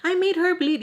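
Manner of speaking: high, smug mock brag of the other girl. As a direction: lightly mimic her gloating. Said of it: "homegirl thought she was".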